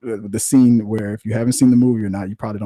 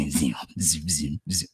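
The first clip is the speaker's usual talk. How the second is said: lightsaber sound effect; 6 semitones lower, 8 dB quieter, slower than usual. Retold or imitated.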